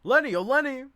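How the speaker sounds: high, loud sing-song call from offstage; calling Lenny from outside the door; calling from off stage. A narrator's reading. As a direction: call her name from another room, loud and high. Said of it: "we hear Chick's voice from off stage".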